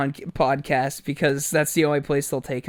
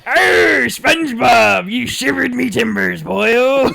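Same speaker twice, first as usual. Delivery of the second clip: loud, high, slow cartoon crab-captain voice; Mister Krabs; 7 semitones higher, 8 dB louder, slower than usual. Doing a Mister Krabs impression for his cohost. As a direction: ham up a gruff cartoon sea captain. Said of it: "I was thinking more Mister Krabs".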